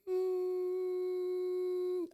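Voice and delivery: a high thin ringing hum imitated; imitated tinnitus hum, high and faint; ear ringing hum. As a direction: hum the ringing in your ears after a knock. Imitates ringing in ears after elbow.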